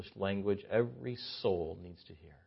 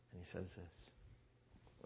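whisper; 5 semitones lower, 26 dB quieter, much slower than usual. Acted. Hushed lead-in to quote.

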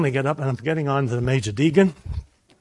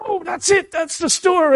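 excited discovery; 14 semitones higher, 4 dB louder than usual. Retold or imitated. Spotting the store at last.

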